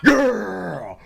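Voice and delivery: high, slow, gloating brag; boastful guard. Acted. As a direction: drag it out like a proud show-off.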